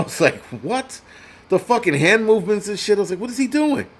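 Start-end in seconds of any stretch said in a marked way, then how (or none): 0.6-1.0 high-pitched, rising retold disbelief; baffled disbelief. Streamer retells his reaction to the plea, mock baffled. 3.2-3.9 very high-pitched mock bewilderment; baffled disbelief. Streamer reacts to odd hand movements, high mock bafflement.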